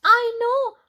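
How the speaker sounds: high, loud eager blurting; eager kitten. Acted. Kitten eagerly offers her idea, high and loud. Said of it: "said Kitten".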